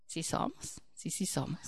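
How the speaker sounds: hushed mock confession; whisper. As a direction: breathe the admission under your voice. Whispered self-answer.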